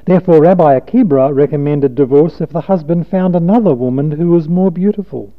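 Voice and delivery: usual speaking voice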